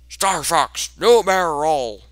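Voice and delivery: high, loud cartoon commander shout; General Pepper. Shown as a demonstration. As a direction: belt it out like a game character. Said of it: "That was my best General Pepper impression".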